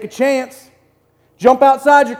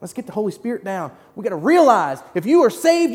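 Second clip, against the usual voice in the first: normal talking voice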